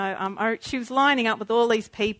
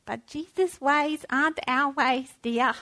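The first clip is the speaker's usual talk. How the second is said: feeble Swiss grandmother; 3 semitones higher, slower than usual. Retold or imitated. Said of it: "in her Swiss accent and the feeble little voice".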